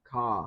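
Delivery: "Ca." said with no R, an open "cah"; Boston pronunciation. Shown as a demonstration. Host showing chat the Boston way to say car, no R. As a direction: drop the R in "Ca." and open the vowel, Boston style. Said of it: "It's pronounced ca".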